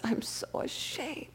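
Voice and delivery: a choked, tearful whisper; imitating a drunk breaking into tears; sobbing shame. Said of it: "And he begins to sob".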